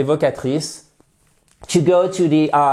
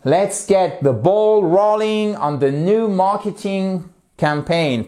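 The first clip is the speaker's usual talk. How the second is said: slow, higher English model line; slow model sentence; 3 semitones higher, slower than usual. Shown as a demonstration. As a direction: slowly model it for students to repeat. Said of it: "allez-y".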